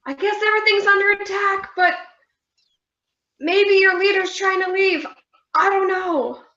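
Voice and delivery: high, bewildered character voice; confused lady voice. Acted.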